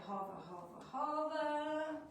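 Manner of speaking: high, slow sing-song hover, hover. Shown as a demonstration. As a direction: chant it to the class. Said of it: "teacher cue to students".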